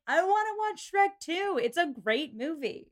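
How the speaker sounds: high-pitched mock protest; hammed-up, insistent retelling of a plea; emphatic pleading. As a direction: plead your case like it's obvious. Retells pleading to watch film.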